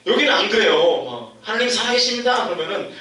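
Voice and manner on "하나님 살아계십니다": raised proclaiming preacher voice; mock pulpit proclamation. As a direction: declare it like a preacher.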